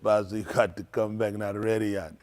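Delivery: lightly voiced Jamaican man; low cook voice. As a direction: drop into the cook's voice.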